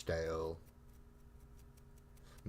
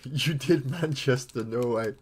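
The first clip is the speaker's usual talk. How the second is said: excited disbelief; 5 semitones higher, 10 dB louder, slower than usual. Real, not acted. Reacting excitedly to a viewer comment.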